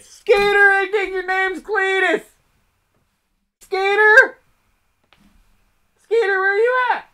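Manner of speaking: loud, strained yokel yell; yelling hillbilly. Acted. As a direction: holler across the yard like a panicked hillbilly. Acted hillbilly calling out for Skeeter.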